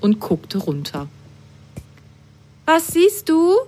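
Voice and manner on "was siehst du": high, eager curious question; high excited curiosity. Acted. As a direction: ask it wide-eyed and eager. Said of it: "fragte Apfelgrün neugierig".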